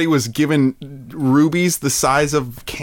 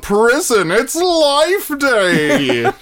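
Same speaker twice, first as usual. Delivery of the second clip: announcer voice; 11 semitones higher, slower than usual. Pretend. Prison Life Day announcement.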